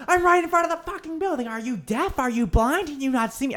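high, exasperated mock yell; angry retort. Yelling back at mom outside South Station, high and exasperated. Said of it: "I'm like, I'm like".

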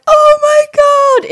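high, loud cry of pain; yelling oh my God in pain; retold shout. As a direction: shriek it like the toe just split. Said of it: "I literally shouted so loud".

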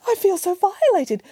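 high-pitched, distressed lament; mother bear crying out. A narrator's reading. Mother bear after the break-in, distressed cry. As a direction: cry it high, dramatically wounded. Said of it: "cried the mother bear".

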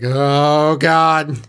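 mock panic. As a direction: tease her car fright with a hammed-up gasped oh God. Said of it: "you're always just kinda like".

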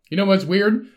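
ordinary delivery

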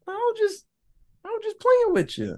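high, sing-song creepy taunt; creepy villain voice. Retold or imitated. Rusty Nail taunting Fuller over the CB radio, high sing-song creepy voice. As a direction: toy with them in a sickly sweet voice. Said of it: "Rusty Nail's like".

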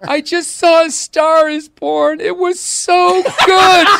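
high, slow mock wail of a tearful wife; hammed-up sobbing, drawn out and loud; crying hysterically. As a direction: imitate a wife blubbering over a movie. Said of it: "she was crying hysterically".

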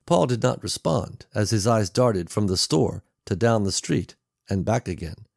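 ordinary delivery

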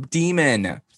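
ordinary talk, nothing marked

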